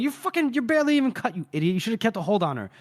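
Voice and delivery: high, indignant comeback in imitation; mock exasperation. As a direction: act out a scolding retort, starting way up. Acted quarrel, Faithful snaps back.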